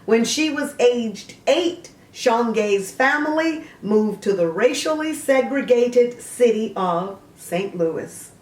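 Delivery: a bright encyclopedia read; reading a biography entry aloud; reading aloud from Wikipedia. Acted.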